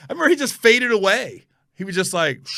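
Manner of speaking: normal talking voice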